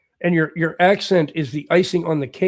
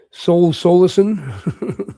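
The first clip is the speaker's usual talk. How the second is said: drawn-out name; 5 dB louder, much slower than usual. Real, not acted.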